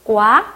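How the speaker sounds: 꽈 with a tense k before the wa; northern Vietnamese reading. Shown as a demonstration. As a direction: model 꽈 with a hard k onset. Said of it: "북부에서는 요거 어떻게 읽을까요".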